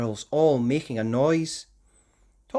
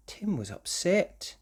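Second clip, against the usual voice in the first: ordinary delivery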